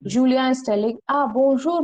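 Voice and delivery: normal talking voice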